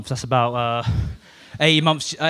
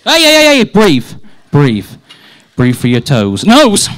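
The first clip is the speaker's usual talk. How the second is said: calming, urgent; 3 semitones lower, 6 dB louder, slower than usual. Acted. Calming a flustered colleague.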